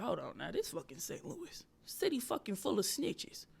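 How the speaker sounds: quiet aside to oneself; hushed inner thought. Retold or imitated. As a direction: drop to a near-whisper, thinking aloud. Inner thought during her set.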